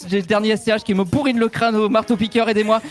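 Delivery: high, jittery over-caffeinated DJ; wired hip-hop DJ. Acted. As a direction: rattle it off like a wired DJ.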